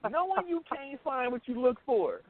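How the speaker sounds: normal talking voice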